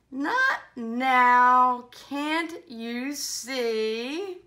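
relishing read-aloud, punched for effect; animated story reading. Acted. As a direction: build from calm to a loud comic refusal.

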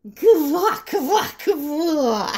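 high croaking laugh; laughing frog. Acted. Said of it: "засмеялась лягушка".

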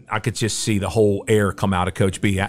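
usual speaking voice

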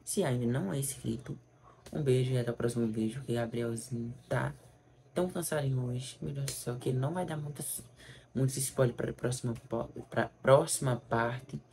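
softly winding down the video; tired and soft, signing off; quiet, tired outro. Real, not acted.

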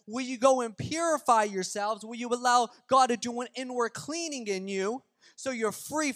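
full-force preaching. Real, not acted. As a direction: drive each point home to the congregation. Preaching the main point.

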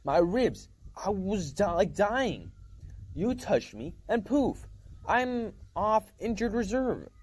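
put-on teen boy raving, stunned and thrilled; excited outburst. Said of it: "I shouted".

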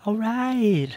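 playful high wolf-like voice; high silly yips and praise. Pretend. Playful high voice after howl.

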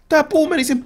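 high, loud amazed exclaim; excited disbelief. Real, not acted. Tree falls on an enemy, amazed high-pitched exclaim. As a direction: blurt it out in shock.

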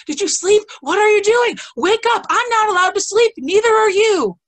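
high, loud yelling at snails; yelling kids. Retold or imitated. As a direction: yell at the racing snail. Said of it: "when they're yelling at their snails".

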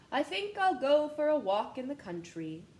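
a touch of the peddler deciding. Acted. Peddler deciding aloud on a country walk, lightly voiced. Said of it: "said he".

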